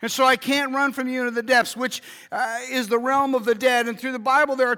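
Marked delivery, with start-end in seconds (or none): none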